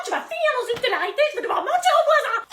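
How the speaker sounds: laughing rant. Acted. Mother's exaggerated rant.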